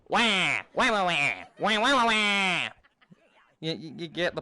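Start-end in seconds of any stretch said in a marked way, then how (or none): 0.1-2.7 imitating babble as wah wah wah; slow sing-song wah noises; mock gibberish wah wah. Showing how Penguinese sounds to them, mock wah babble. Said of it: "it would sound like a bunch of".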